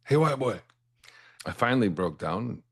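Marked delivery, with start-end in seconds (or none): 0.0-0.6 higher, persistent call across the hallway. Retold or imitated. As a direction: keep calling him, patiently insistent. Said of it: "he just persisted every day".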